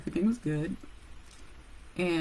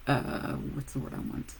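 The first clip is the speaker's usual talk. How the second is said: ordinary talk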